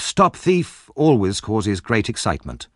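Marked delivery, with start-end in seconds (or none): 0.0-0.9 high-pitched call of alarm; crying out. A narrator's reading. Narrator quoting the crowd's cry, calling out. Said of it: "The cry of".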